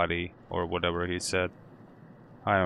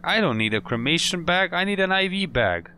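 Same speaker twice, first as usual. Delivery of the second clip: frustrated; 10 semitones higher, 4 dB louder than usual. Real, not acted. Frustrated, can't find IV bag.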